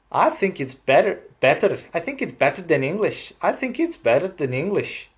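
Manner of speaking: high, swooping Welsh sing-song; Welsh accent. Shown as a demonstration. Claiming Welsh beats English, in a lilting Welsh accent. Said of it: "I'm speaking Welsh".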